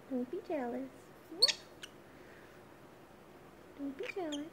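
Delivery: sing-song pet talk. Real, not acted. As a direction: coo at the pet birds. Talking to pet birds.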